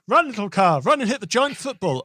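high, loud, eager cheer; excited cheering. Pretend. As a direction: urge the little car on. Voicing the player's thoughts.

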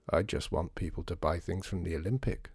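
a touch of sadness, said softly; sadly. Acted.

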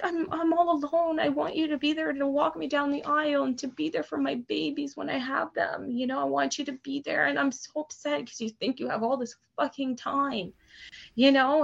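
high-pitched, upset pleading; tearful plea. Retold or imitated. Daughter begging dad to be there, tearful plea. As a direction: relive pouring it out through tears. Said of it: "I started hysterically just bawling".

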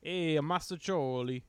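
drawn-out hammy Italian call; mock Italian accent. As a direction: stretch it out like a fake Italian. Joking attempt at Italian.

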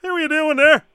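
a bigger, brighter customer greeting; a hammed-up Leo greeting. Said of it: "Now let's just slow it right down".

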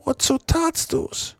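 high, slow aghast question; frightened drunk asking his accomplice; horrified Potter. Acted. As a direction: ask as if you can't believe it. Potter asks in horror.

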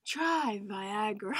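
slow, smooth commercial narrator; ad voiceover. Retold or imitated. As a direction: sell it slowly like a pill ad. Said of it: "those blue pill commercials".

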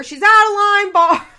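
high, loud imitated scolding yell; finger-wagging scold. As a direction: wag the finger and shout it. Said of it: "waving her finger".

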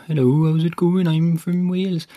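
lightly imitating a Welsh guy; Welsh accent. Host demos how the Welsh talk, light Welsh accent. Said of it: "he talks like this, the Welsh do".

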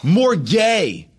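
loud, high barked order; barked direction. Retold or imitated. Director barking a note at an actor, loud high bark. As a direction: snap out the adjective like a command. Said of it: "to just bark out adjectives".

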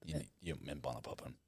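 very quiet and low; hushed, low-pitched explanation; subdued. Real, not acted. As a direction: drop right down to a low murmur. Recovering from laughing, explains sheepishly.

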